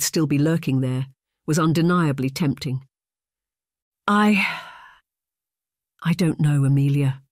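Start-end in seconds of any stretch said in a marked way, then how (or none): 4.1-7.2 halting, with a long pause after the first word; faltering, unsure reply; hesitant. A narrator's reading. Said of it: "her voice hesitant".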